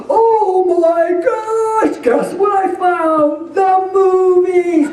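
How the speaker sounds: drawn-out delighted shout; excited discovery. Retold or imitated. Husband bursting out that he found the lost movies, excited. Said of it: "Bob goes".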